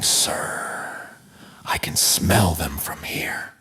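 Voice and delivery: low, slow near-whisper; hushed aside. Acted. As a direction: drop to a soft, unimpressed murmur. Deadpan aside to the screaming farmer.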